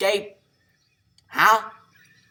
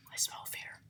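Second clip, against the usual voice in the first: breathy creeping zombie whisper; 19 dB quieter than usual. Acted.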